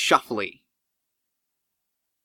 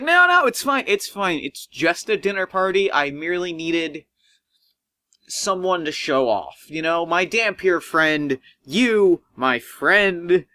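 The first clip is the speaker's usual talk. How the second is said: dismissive brush-off; 3 semitones higher than usual. Acted. Doctor Cook brushing off the guest, high and breezy. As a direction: wave it away airily. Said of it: "he brushes you off".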